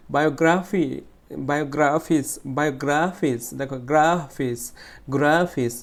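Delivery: "Biographies" with গ্রা stretched and punched; stress on গ্রা. Shown as a demonstration. Teacher drilling a stressed syllable for students, stress on গ্রা. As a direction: hit the long গ্রা in "biographies" each time. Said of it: "stretch করতে হয়েছে".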